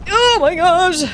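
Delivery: high, loud startled oh my gosh. Real, not acted. Streamer startled by something in the game, high and loud. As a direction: jump at a sudden scare. Game scare mid-sentence.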